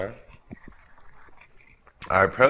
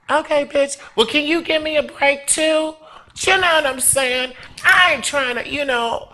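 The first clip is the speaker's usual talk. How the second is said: sassy inner voice. Retold or imitated. Inner voice retort.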